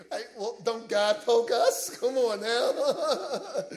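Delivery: amused, chuckling through the words; laughing. Real, not acted. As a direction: talk through real laughter. Laughing with congregation at his joke.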